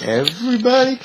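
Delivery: mock astonished squeak; shocked question. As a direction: disbelief rising on the word. Shocked that all know his name.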